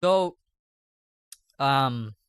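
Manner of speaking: candy in mouth; sucking a hard candy. Real, not acted. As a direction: talk around a hard candy. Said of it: "I have candy in my mouth".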